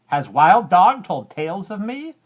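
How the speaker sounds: high, loud furious outburst; angry cat. A narrator's reading. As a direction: snap it out in a sudden rage. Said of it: "Cat grew very angry and said".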